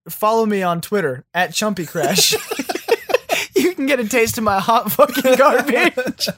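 laughing loudly through the plug. Real, not acted. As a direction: crack up while plugging. Laughing plug, loud high.

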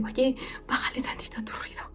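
whispered, soft reprimand; a mother whispering to turn it down; hushed whisper. Retold or imitated. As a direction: hush her in a whisper. Quoting being told to hush.

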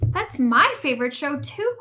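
high, loud excitement; excited cry. A narrator's reading. Rabbit learns they will watch his favorite, excited. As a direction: exclaim it with delight. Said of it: "cried Rabbit".

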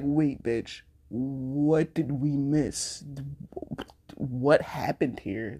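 slow, acted-out puzzlement; mock bewilderment. Talking to herself over a sudden tear, mock bewildered. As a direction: draw it out, lightly playing baffled.

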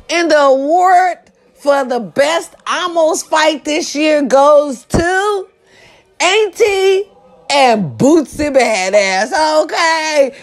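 mock award presenter. Host awarding best almost fight, mock ceremonial announcement. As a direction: stretch out the winners' names like a host.

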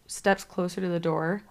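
ordinary talk

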